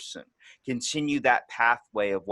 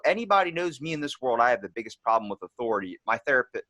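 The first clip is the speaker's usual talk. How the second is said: ordinary talk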